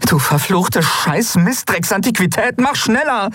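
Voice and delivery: high, exasperated swearing; cursing. Acted. Endriel curses the ancient ship to speed up, exasperated. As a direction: curse the useless old machine, high and urgent. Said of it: "fluchte Endriel".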